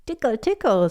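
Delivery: put-on high, playful child's voice; tickling tease. George teasingly tickling Grandpa.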